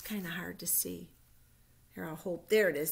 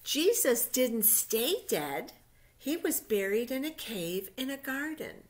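reading a Bible story aloud, louder and measured; story narration; 2 semitones higher, 7 dB louder than usual. A narrator's reading. Teacher reading Easter story to children, storybook narration. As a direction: tell it like a picture-book story to little ones.

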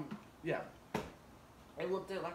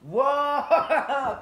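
surprised laughing shout; 11 semitones higher, 18 dB louder than usual. Real, not acted. Burning spice hits suddenly.

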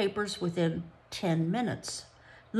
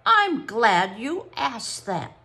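whinnying; 5 semitones higher, 6 dB louder, slower than usual. Acted. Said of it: "she whinnied".

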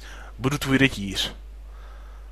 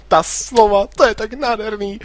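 much higher, swooning put-on girl voice; dreamy girl voice; 7 semitones higher than usual. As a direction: gush it like a lovestruck heroine. Repliku přehraje snivým hlasem. (zasněný, výrazně vyšší dívčí hlas)

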